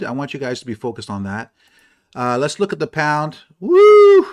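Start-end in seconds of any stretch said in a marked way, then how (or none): 3.6-4.3 sudden high, loud whoop; excited woo. Real, not acted. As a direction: whoop with delight. Excited at price chart.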